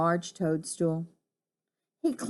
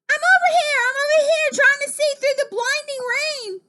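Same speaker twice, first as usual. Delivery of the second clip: very high, loud frantic answer; shouting back; 5 dB louder than usual. Acted. Frog answering rescuers through blinding rain, shouting. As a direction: holler high to be found.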